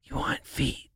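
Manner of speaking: hushed, furtive whisper of an offer; whispered in ear. Retold or imitated. Masseuse quietly offering an extra to the client, whispered. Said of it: "She goes into my ear".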